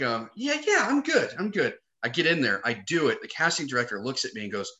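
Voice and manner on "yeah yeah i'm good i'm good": much higher, put-on English accent; bad English accent. Actor recalling his failed audition, bad English accent. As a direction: mimic your own unconvincing English accent. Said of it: "I kinda sound like".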